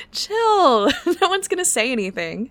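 chuckling through the words; laughing reassurance. Acted. Brushes off alarm while laughing.

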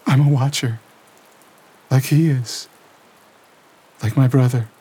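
put-on high, tense young woman's voice reliving horror; Lena shaken. A narrator's reading. Lena telling Gabriel what she felt, shaken and tense. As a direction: voice Lena trembling through the vision.

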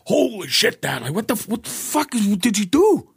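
high, alarmed voicing of the sons bursting in; shocked sons. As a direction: play the kids gasping at their dad.

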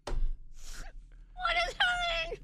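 very high, slow, crying wail; mock wail. Woken again by child, high crying wail. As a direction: wail it in despair.

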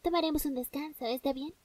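soft, hushed soothing voice. Pretend. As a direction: coax her gently to sleep.

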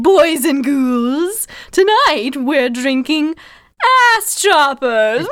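slow, high, drawn-out ghoul host; Crypt Keeper voice. Acted. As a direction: play the cackling horror host. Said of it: "you gotta say it like Crypt Keeper".

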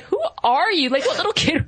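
mock bewilderment. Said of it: "and I'm like".

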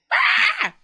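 sudden high yelp of a shout; short mock outburst; mock yell. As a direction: yelp it like losing it at the kid. Mock yelling at the kid.

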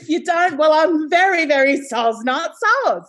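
high, loud, playful non-apology; hammed-up cheeky defiance, pitched right up; mock defiance. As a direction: throw it out big and high, cheekily unapologetic. Mock-defiant sign-off on accents.

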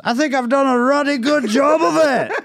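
hammed-up Scottish husband, laughing; Scottish husband punchline. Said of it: "told in a very Scottish accent".

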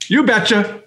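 chirpy Minnesota "You betcha"; Minnesota accent. Retold or imitated. Host repeating his favorite Minnesota phrase, singsong accent. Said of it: "the two I really like is".

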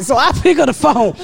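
ordinary talk

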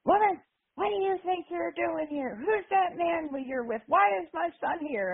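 high-pitched holler, no real volume; mock hollering. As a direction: imitate a wrestler hollering at a woman. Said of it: "he hollers at me".

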